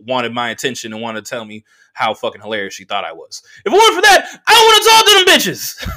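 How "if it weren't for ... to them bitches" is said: high, loud exasperated protest; indignant outburst. Real, not acted. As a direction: burst out, pitch shooting right up. Indignant outburst about the interrupted talk.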